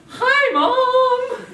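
high, loud sing-song greeting; overly sweet mock hello; sing-song fake cheer. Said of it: "and she'd go".

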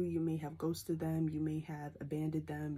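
usual speaking voice

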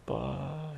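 mock crying wail. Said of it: "he's crying".

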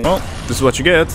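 usual speaking voice

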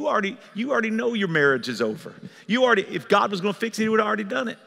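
normal talking voice

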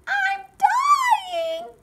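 put-on very high, loud baby-bear wail; hammed-up squealing cry. Baby Bear burned by porridge, melodramatic high wail. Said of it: "cried Baby Bear".

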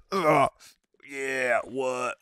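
ordinary talk

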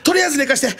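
high, strained plea to be left to sleep; imitating a distressed plea; pleading. Partner begging to sleep.

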